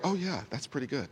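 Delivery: quick, very soft aside; unimpressed congregant. Acted. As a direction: toss it off under your breath as a listener. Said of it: "Some of you are like".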